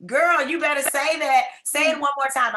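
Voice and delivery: high, loud, delighted egging-on; excited urging. Real, not acted. Host cheering on a participant who spoke up, excited urging.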